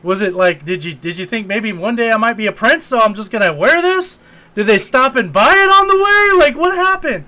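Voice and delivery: mock exasperated questioning; incredulous rant. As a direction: pile up the questions in disbelief. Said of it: "I'm like".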